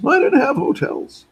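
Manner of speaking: high, loud put-on voice of a friend; imitating Kellett. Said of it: "That's how he talks, by the way".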